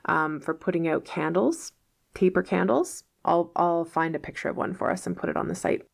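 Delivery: usual speaking voice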